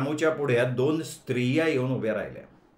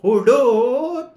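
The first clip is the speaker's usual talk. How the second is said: put-on high, slow, loud question from a hard-of-hearing old aunt; 8 semitones higher, 4 dB louder, much slower than usual. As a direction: stretch it out, pitched up and loud like an old aunt mishearing. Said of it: "आणखीन मोठा आवाज चढवून विचारती झाली".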